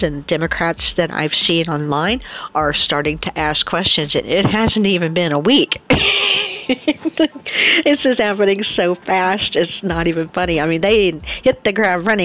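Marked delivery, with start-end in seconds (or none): 5.8-7.8 high laugh. Real, not acted. Laugh after remark.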